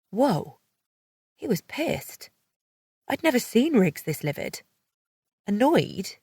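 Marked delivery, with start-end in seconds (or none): none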